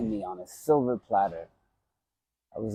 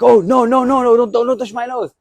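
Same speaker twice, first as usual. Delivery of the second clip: much higher, louder frantic begging, imitated; panicked pleading; 13 semitones higher, 11 dB louder than usual. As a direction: beg them not to touch the broken nose. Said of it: "And I'm like".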